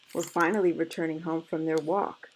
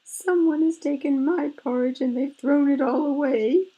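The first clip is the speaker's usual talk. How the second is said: put-on high, loud baby bear cry; tiny bear crying out in a raised, squeaky pitch; wee little voice; 10 semitones higher, 8 dB louder than usual. A narrator's reading. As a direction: wail it high like an upset little cub. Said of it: "in his wee little voice".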